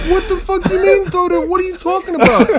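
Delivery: high, stunned question; disbelief. Retold or imitated. [name] on the phone, shocked at the news, high. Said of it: "I'm like".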